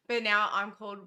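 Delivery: ordinary delivery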